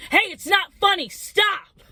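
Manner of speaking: imitated sudden shout, high and loud; angry outburst. Storyteller as sleeping friend suddenly scolding, angry shout. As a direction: yell it as a sharp scolding. Friend snaps during laughing.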